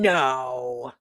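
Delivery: ordinary talk, nothing marked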